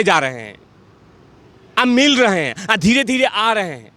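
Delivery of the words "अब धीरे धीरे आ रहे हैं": normal talking voice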